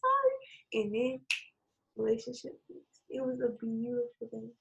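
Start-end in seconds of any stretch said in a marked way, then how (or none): 0.0-0.8 high, mock-crying voice; retold tearful apology. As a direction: imitate a daughter tearfully apologizing, much higher. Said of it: "she started crying".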